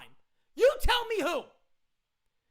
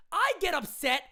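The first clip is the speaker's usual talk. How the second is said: ordinary talk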